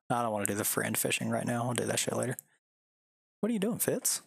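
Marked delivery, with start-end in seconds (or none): 3.4-4.2 high, coaxing voice to the dog; pet talk. Real, not acted. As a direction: coo at the little dog. Talking to his dog.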